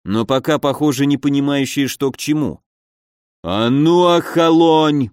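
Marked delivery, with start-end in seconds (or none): 3.4-5.1 slow, drawn-out growl of command; growled order. A narrator's reading. Uncle reins in his hot-headed nephew, growling. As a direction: growl it out to rein him in. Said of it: "прорычал Данислав Игоревич".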